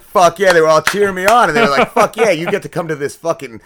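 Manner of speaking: hammed-up cheer of the crowd; coworkers cheering. Coworkers cheer on their new manager, loud and high.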